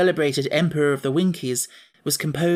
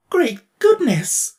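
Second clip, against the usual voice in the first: slow, much higher, louder exclamation; shocked cry; 11 semitones higher, 7 dB louder, much slower than usual. Acted. Emperor hearing of the revolt, shocked cry. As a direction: gasp out in alarm. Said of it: "cried the Tin Woodman".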